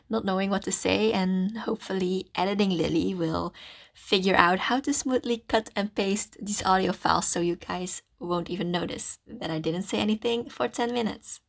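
ordinary talk

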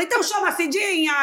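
high imperious demand; imitating Narcisa. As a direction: call for help like a diva. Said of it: "Aí ela". (chamando a Cidinha em voz aguda)

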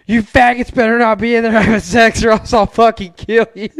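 hammed-up pounding-on-the-door shout; mock yell through door. Buddy banging on door.

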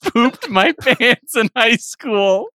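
cracking up mid-line; can't keep a straight face; laughing. Real, not acted. Comedian cracking up at his own punchline.

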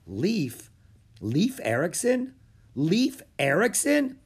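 dawning amazement. A narrator's reading. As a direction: let the realisation grow to a slow, loud finish. Jack realises who the boy is.

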